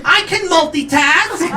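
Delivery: very high, drawn-out retort; defensive young woman. Retold or imitated. Younger lover caught texting, defensively shrill retort. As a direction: protest in a high mock female voice.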